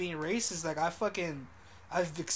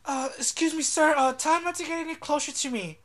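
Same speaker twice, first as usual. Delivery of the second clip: high, raised mock-indignant voice; imitated complaining customer; 9 semitones higher, 6 dB louder than usual. Retelling the lady complaining to the employee, mock indignant. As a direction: act out a woman appealing to staff. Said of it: "she tells the employee".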